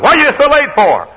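high, loud imitated mother's yell; scolding mother. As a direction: shout it as an exasperated mom. Mother scolds late son, retold.

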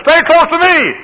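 very high, loud pulpit shout; shouted command. Retold or imitated. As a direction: holler it as an urgent warning. Lord's warning repeated, shouted.

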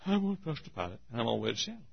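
soft, settled and quiet; calm quiet trust. Pretend. Preacher voicing a calm trusting flyer, quiet and soft. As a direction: say it with quiet confidence. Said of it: "Or I could have gotten on and said".

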